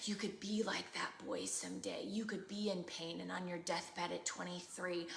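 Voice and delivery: hushed, low imitation of an enemy's whisper; sinister whisper. The enemy whispering fears to a child, imitated in a hushed low whisper. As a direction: whisper it like a tormentor in the ear. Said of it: "whisper in your ear and say".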